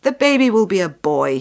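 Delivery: loud, pleased soldier's announcement; voiced happy soldier, full and loud. A narrator's reading. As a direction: declare it loudly with glad confidence. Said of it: "the soldier was very happy".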